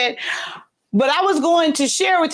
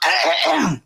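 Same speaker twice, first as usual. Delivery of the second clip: harsh choking cough; coughing up water; 3 semitones higher than usual. Real, not acted. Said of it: "some water went down the wrong way".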